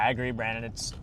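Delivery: normal talking voice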